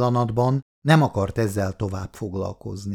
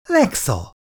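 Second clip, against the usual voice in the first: high, pleased exclamation; brightening up; 8 semitones higher than usual. A narrator's reading. Sleepy girl spotting the visitor, suddenly cheered high greeting. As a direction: greet her with sudden delight. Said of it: "a hangulata azonnal felderült".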